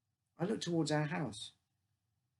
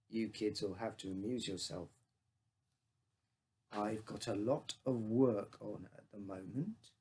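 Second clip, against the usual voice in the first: heavy voice; 5 semitones lower, 3 dB quieter than usual. A narrator's reading. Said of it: "with a funny, heavy voice".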